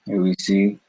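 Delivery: usual speaking voice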